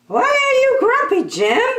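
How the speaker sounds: very high, fast, chirpy question; a tiny bird piping up; squeaky bird voice. Acted. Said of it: "asked Marabou".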